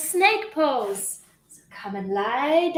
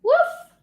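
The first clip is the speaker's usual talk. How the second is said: short, high-pitched woof; high imitation dog bark. Shown as a demonstration. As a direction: bark like a small dog, rising sharply. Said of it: "What does the dog say?".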